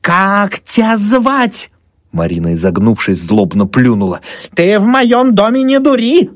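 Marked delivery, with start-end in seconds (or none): 0.0-1.7 high, drawn-out challenge; commanding demand. A narrator's reading. Old woman raising cross over Marina, commanding. Wife confronts demon holding cross. 4.5-6.3 very high, loud squeal; angry shriek. A narrator's reading. Old woman scolding possessed Marina, shrieking. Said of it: "взвизгнула Анфиса Павловна".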